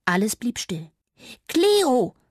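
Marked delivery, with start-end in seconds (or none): 1.5-2.2 high, loud squeaky call; shouted Cleo in a high mouse voice; loud squeak. Acted. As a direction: squeak the name out as loud as a tiny mouse can. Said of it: "versuchte sie es noch einmal".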